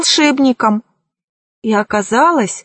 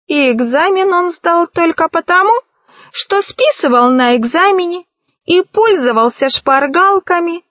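high-pitched character voice, accusing; mocking henchman; 6 semitones higher than usual. A narrator's reading. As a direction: tell on him in a high voice. Narrator voices the merry henchman.

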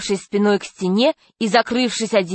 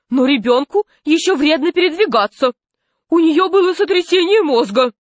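higher-pitched, urgent objection; alarmed protest; 8 semitones higher than usual. A narrator's reading. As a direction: object anxiously on a child's behalf. Nurse protests to doctor.

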